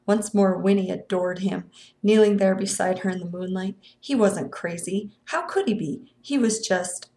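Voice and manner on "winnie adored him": usual speaking voice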